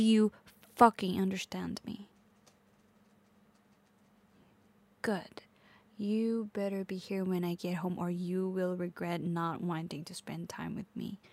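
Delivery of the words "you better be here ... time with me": low, soft, menacing warning; quiet threat. Acted. Girlfriend warning partner to be home, quiet threat. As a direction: drop the voice and warn them.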